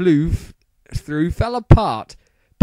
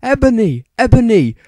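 worried; 9 semitones higher, 6 dB louder than usual. A narrator's reading. Draco calling worriedly to Ebony. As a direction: call out to her in worry. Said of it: "asked Draco in a worried voice".

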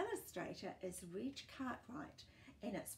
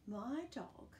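usual speaking voice

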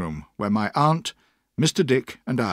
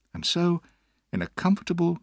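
ordinary delivery, nothing marked

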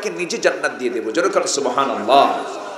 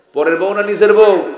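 louder repeat; 7 semitones higher, 9 dB louder than usual. Shown as a demonstration. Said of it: "আসতে কয়".

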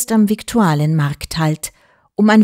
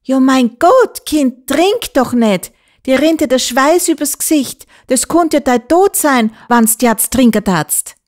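alarmed calling; 6 semitones higher, 4 dB louder than usual. A narrator's reading. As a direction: warn the child urgently not to drink. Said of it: "lief eine Frau auf mich zu und rief".

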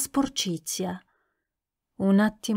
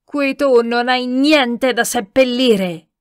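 slow, loud, furious shout; yelling; 3 semitones higher, 6 dB louder, slower than usual. A narrator's reading. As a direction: roar it slowly, word by word. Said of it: "urlò Tarabas". (urlo furioso e scandito)

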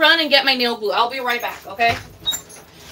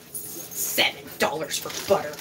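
hissed disbelief; 9 semitones lower, 10 dB quieter than usual. Retold or imitated. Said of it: "I was like".

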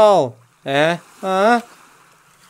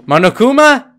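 surprised, higher and louder, rising sharply; startled question; 6 semitones higher, 5 dB louder than usual. Real, not acted. Player spots Monokuma in the game, startled question. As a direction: call the name as a startled question. Player reacts to character appearing.

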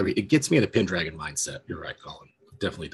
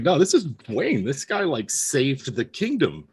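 starstruck; 7 semitones higher, 4 dB louder, slower than usual. Retold or imitated. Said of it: "Hrothgar's like".

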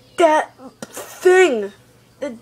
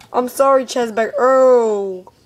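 usual speaking voice

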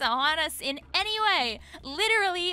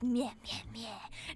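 fading mock meh sounds, dropping to a whisper; imitated meh; 6 semitones lower, 11 dB quieter, much slower than usual. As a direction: bleat meh meh, then whisper the last. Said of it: "make her go like".

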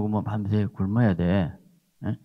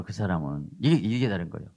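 usual speaking voice

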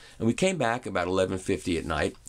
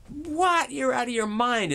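much higher, scoffing disbelief; mock incredulous Howard; slower than usual. Howard Stern scoffs at the vinyl comeback claim in high mock disbelief.

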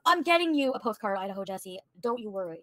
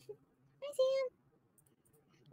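cooing hi; 5 dB quieter than usual. Real, not acted. Cooing greeting.

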